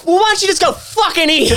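very high, hammed-up yell; mock furious shout. Retelling the shouting match in the casino, mock furious shout. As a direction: throw it back as a shout. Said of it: "then go".

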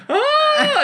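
very high, sustained mock scream; imitated scream. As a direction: squeal like a panicked woman on the stairs. Imitating woman screaming on stairs.

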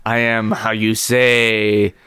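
put-on slow, lightly accented French; mock French accent.

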